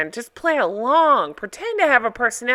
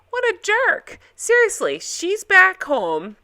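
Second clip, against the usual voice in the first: ordinary talk, nothing marked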